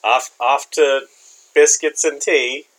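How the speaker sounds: slow, put-on English voice; mock British accent. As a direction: drawl it out like a stage Englishman. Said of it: "Say it with a British accent".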